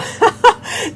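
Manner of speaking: a high giggle bursting out. Real, not acted. Soaper delighted by neon colors, high giggle.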